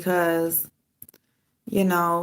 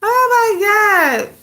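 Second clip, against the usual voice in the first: high, drawn-out oh my God; exasperated; 14 semitones higher, 6 dB louder, much slower than usual. Real, not acted. Woman fed up with her dog, slow and high. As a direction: stretch out the exasperation.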